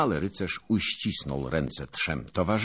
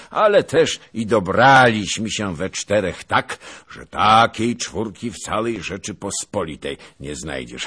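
put-on raised, booming old nobleman's voice; pompous veteran holding forth at dawn; boastful old noble; 6 semitones higher, 9 dB louder than usual. A narrator's reading. Zagłoba boasting to his fellow knights, booming and pompous.

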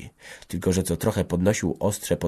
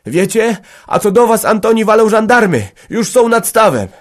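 very high, loud breaking news; alarmed; 14 semitones higher, 10 dB louder than usual. A narrator's reading. Michał rushing in to warn of gendarmes, alarmed shout. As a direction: cry out the warning in alarm. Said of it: "przyleciał srodze zdziejany Michał".

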